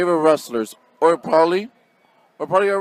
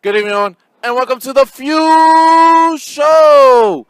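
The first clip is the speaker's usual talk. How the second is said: slow booming announcer call; 8 semitones higher, 10 dB louder than usual. Acted. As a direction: draw out the show name. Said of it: "You started with something actually pretty cool".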